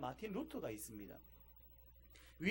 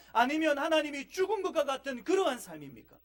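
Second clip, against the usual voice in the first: ordinary delivery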